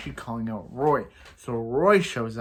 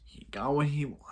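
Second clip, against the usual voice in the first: soft, breathy whisper; hushed, voiceless remark; whispered aside; 5 dB quieter than usual. Pretend. Aside about the villain winning.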